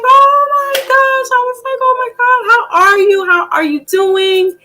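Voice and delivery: very high, squealing gush; starstruck gush. Retold or imitated. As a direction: squeal like meeting a celebrity. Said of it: "And I was like".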